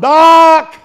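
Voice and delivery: loud high call across the road; hollered name. Retold or imitated. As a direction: yell for the dog down the road. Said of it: "and I hollered".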